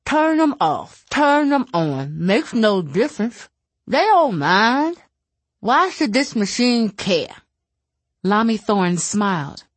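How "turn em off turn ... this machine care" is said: stubborn, raised pitch, slower dismissive voice. Acted. Alice scoffing at machines, stubborn and dismissive. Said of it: "said stubbornly".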